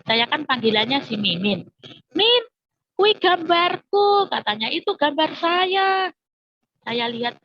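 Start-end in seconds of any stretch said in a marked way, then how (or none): 2.1-4.3 put-on high, loud drawn-out child's yell; imitated shout. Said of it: "Itu tiba-tiba teriak". 4.7-6.1 put-on high, drawn-out child's cry; imitated shout. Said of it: "Itu tiba-tiba teriak".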